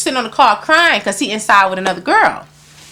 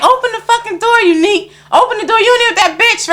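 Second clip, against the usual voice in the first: high-pitched furious demand, retold; retold angry demand; 10 semitones higher than usual. Narrator banging on the bathroom door at her man, retold anger. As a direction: replay yelling through a locked door, pitch way up.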